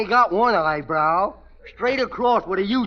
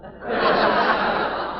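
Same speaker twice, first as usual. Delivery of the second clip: laughter; 7 semitones higher than usual. Real, not acted. Audience laughing at joke.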